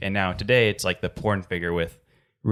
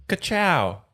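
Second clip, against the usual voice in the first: high, loud cartoon race car catchphrase; Lightning McQueen; 11 semitones higher, 5 dB louder than usual. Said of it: "Lightning McQueen".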